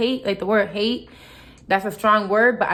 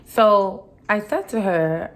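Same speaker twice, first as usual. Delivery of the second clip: ordinary delivery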